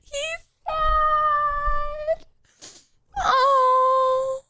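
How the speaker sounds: high, choked-up whimper; teary. Real, not acted. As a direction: voice breaking with pity. Sad dog picture from mom.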